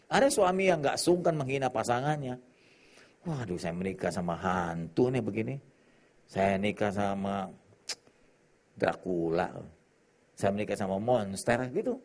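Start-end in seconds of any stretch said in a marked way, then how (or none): 3.2-11.6 sneering husband. Retold or imitated. Husband insulting wife, muttered.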